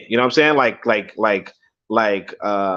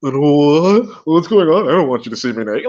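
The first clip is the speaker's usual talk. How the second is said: mock shy woman; 2 semitones higher, 4 dB louder than usual. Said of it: "This woman's sitting there like".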